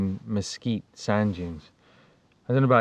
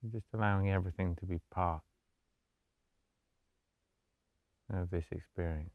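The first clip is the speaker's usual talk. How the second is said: soft, low-key meditation voice with long pauses; guided meditation; 4 semitones lower, 10 dB quieter than usual. Acted. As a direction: gently guide them into stillness, hushed and unhurried.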